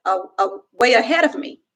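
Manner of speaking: ordinary delivery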